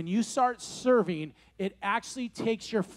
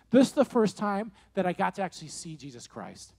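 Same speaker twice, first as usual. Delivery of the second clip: normal talking voice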